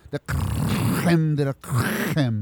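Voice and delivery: "creme de la creme" drawn out slowly with a rasped French r; exaggerated French flourish. Host hypes the top award, slow showy French "creme de la creme". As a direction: draw out each "creme", rolling the r hard. Grand build-up to best show award.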